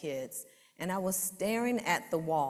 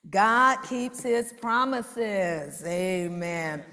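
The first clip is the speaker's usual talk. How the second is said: slow, rising, louder preaching recital; poem recital; 2 semitones higher, 6 dB louder, slower than usual. Acted. Woman reciting her poem to church, building, bold.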